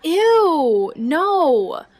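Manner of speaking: loud, high disgusted ew. Acted. Niece recoils at audio drama idea.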